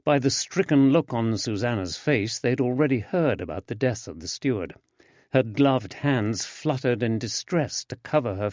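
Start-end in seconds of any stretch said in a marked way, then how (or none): none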